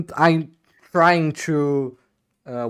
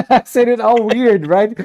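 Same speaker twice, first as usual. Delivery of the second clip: laughing; 9 semitones higher, 6 dB louder than usual. Real, not acted. Laughing at own mispronunciation.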